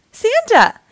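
high, loud cry of delight; excited exclamation. A narrator's reading. Max leaps out of bed on seeing Santa, exclaiming excitedly. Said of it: "exclaimed Max".

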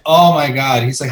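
ordinary talk, nothing marked

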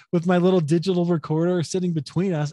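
ordinary delivery

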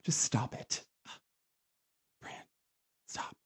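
soft, hushed scolding mom; quiet mock scold; mom hushing. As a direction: hush him like a mortified mother. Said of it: "My mom was like".

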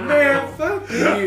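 high, slow laughing comeback; laughing retort. Retold or imitated. Laughing back at the meat pie feet tease, high and slow. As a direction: laugh out the insult. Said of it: "I was just like".

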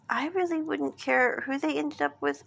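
ordinary delivery

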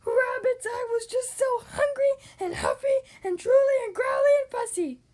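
put-on high, loud, crying wolf boy; whiny wolf boy. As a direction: whine it like a sorry wolf pup. Wolf Boy confessing to rabbits.

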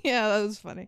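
ordinary talk, nothing marked

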